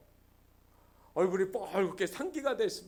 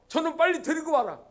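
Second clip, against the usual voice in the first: commanding father; 7 semitones higher, 6 dB louder than usual. Acted. Father imagined ordering servants.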